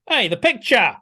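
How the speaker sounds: high, loud shout after someone; imitated yell. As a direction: yell it like an indignant shopkeeper calling them back. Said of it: "Wild Bill yells after them".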